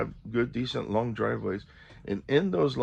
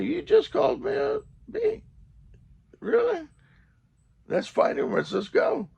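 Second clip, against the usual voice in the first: squeaky high mock disbelief, louder; mock outrage; 3 dB louder than usual. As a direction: act stunned he called you a B. Confronting the cussing driver.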